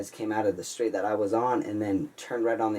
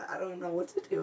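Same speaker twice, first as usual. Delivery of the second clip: high, helpless retold panic; crying, scared; 9 semitones higher, 3 dB quieter than usual. Scared driver pleads with ex-girlfriend, high mock-tearful voice. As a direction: replay a tearful plea, pitched up. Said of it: "I'm crying".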